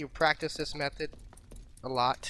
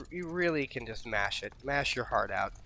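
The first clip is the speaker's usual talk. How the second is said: ordinary delivery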